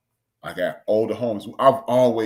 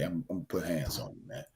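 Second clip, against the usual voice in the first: quiet retold threat; 5 semitones lower, 12 dB quieter, faster than usual. Quiet threat to cursing man.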